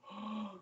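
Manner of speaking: soft, drawn-in mock gasp; imitated shocked gasp. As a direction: gasp like a roomful of shocked onlookers. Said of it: "you can hear the collective gasp".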